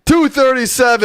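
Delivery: high, thrilled count; excited. Real, not acted. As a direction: cheer the number out. Counting up the membership total.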